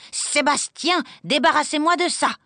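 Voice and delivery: high, commanding call to the servant; ordering. A narrator's reading. Governess ordering the valet to remove kittens, high command. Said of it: "ordonna-t-elle".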